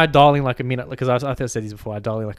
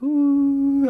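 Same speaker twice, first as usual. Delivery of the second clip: mock campfire "ooh", pitched way up; high, drawn-out ooh; 14 semitones higher, 4 dB louder than usual. Friend mocking dreamy bushfire sitters, a high drawn-out ooh. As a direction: let out a high, held ooh.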